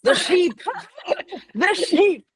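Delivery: high, loud delighted outburst; excited. Real, not acted. Co-host excited at mention of sheep.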